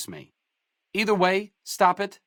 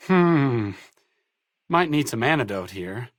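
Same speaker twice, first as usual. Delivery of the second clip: ordinary talk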